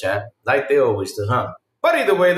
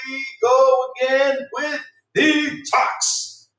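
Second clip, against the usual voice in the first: drawn-out groan; 9 semitones higher, much slower than usual. Pretend.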